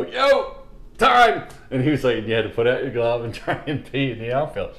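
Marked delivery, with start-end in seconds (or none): 0.0-1.5 high, loud yell across the field; Rosie's yell. Retold or imitated. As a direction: call time at the top of your voice. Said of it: "all you would hear is Rosie go".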